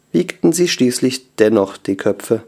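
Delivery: ordinary delivery, nothing marked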